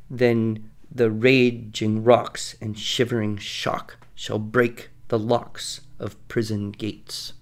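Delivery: slow, beat-marked verse reading; measured iambic chant; iambic recitation. Shown as a demonstration. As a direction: recite with the stress on every second beat. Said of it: "when you have an iambic pattern".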